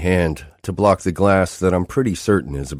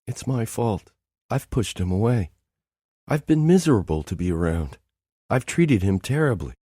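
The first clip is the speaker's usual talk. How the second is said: tearful; 6 semitones higher than usual. Acted. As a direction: confess it through tears. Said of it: "the tears keep on flowing".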